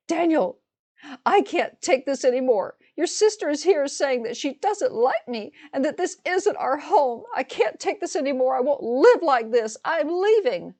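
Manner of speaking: high, loud, overwrought complaint to her husband; hysterical phone yell. Retold or imitated. Sister-in-law on speakerphone to her husband, hysterical and high. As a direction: play the wounded wife wailing down the phone, way up high. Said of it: "that's exactly how she sounded".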